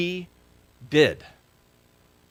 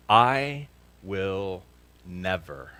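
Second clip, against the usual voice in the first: drawn-out vow; 3 semitones lower, much slower than usual. Shown as a demonstration. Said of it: "fill in the blank".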